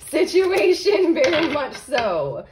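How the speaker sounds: high, loud and stretched out; tickled, drawn-out delivery; laughing emphasis. Real, not acted. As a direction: squeal it slowly through a laugh. Laughing at her straightening pun.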